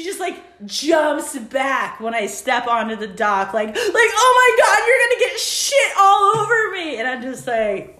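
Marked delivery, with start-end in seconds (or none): 3.8-7.0 high, loud horrified squeal; shrill disgusted woman. Retold or imitated. Woman recoils from her.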